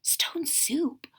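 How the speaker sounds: soft unvoiced whisper. A narrator's reading. Villagers whispering to each other, soft and unvoiced. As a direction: breathe it in wonder. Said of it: "whispered the villagers".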